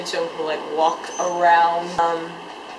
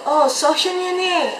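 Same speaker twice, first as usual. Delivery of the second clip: retold yell; 10 semitones higher than usual. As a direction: call out as a pitched-up mock yell. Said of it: "I yelled".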